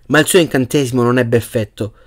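ordinary delivery

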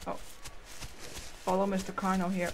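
ordinary delivery

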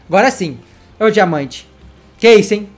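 ordinary talk, nothing marked

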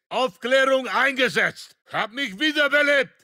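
loud soldier's report; combat callout. Acted. Soldier reporting to squad in battle, called out loudly. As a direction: project it to the whole squad.

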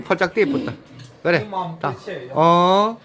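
ordinary delivery

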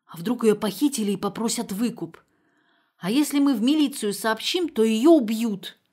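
put-on high, loud and slowing, panicky old mother; anxious mother. A narrator's reading. Mother fearing her daughter kidnapped, rising panic. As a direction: build worry into alarm.

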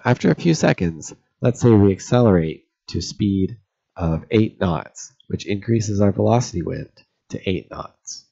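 ordinary talk, nothing marked

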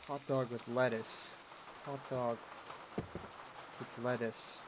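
soft, low muttering; puzzled mutter. Pretend. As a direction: repeat it quietly to yourself in disbelief. Mulling over an odd order.

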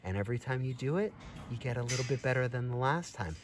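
ordinary delivery

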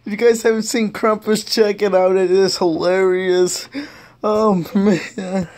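normal talking voice

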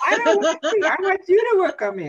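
very high, loud, fast mock whine; whiny client. Client demanding Garrett heal them, squeaky mock whine.

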